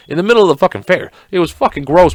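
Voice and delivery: ordinary talk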